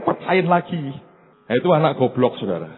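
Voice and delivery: ordinary talk, nothing marked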